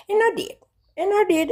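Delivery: squeaky high-pitched boast; high squeal. Real, not acted. Gloating to viewers about laughing, in a high squeal. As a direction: squeal it out in delight.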